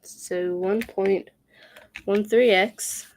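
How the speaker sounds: normal talking voice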